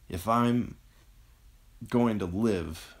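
slow, effortful gasped speech; gasping between words. A narrator's reading. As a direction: push out a few words at a time. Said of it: "he gasped between words".

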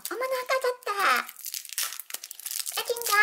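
high gleeful surprise; excited squeal. Real, not acted. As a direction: squeal with delight as it opens. Candy wrapper finally opens.

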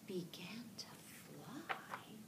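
slow, soft wonder-filled whisper. A narrator's reading. Teacher reading the first flight to children, slow whisper. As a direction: hush it as the ducklings take off. Slow hushed story moment.

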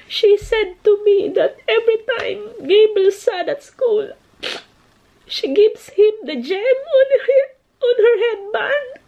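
real choked-up retelling; tearful. Real, not acted. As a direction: voice cracking over a touching story. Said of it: "I'm so emotional".

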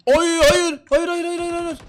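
high, loud panicked cries of hayır; desperate no. Real, not acted. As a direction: wail it as you lose the fight. Streamer getting killed in game.

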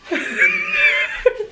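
very high shrieking laugh. Real, not acted. Laughing at friend's reaction.

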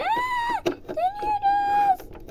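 very high squeaky cry of surprise; squeaky spinning toy. Acted. Toy squeals while spinning round.

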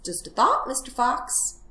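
high opening, lilting suggestion; cunning little piglet making excuses; wheedling piglet. Acted. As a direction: coax the fox with feigned innocence. Plays the piglet.

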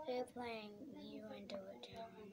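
soft unvoiced whisper. Real, not acted. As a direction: whisper it secretly. Kid vlog aside.

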